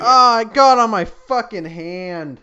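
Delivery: startled outburst. Real, not acted. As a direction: blurt it out as the drink spills on you. Can spills while cracking one open.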